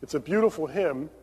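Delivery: ordinary talk, nothing marked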